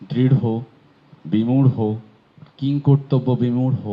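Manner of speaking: দৃঢ় and বিমূঢ় each ending in a breathy rh; h added to ঢ়. Shown as a demonstration. Teacher drilling the aspirated flap. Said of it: "হয়কার হয়ে যাবে".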